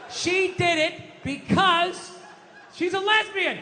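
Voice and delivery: high, drawn-out triumphant declaration; emphatic revelation. Acted.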